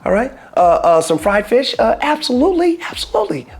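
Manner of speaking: rising giddy delight, nearly squealing the absolutelys; excited. Real, not acted. To viewers, confessing fried food is delicious, giddy and high.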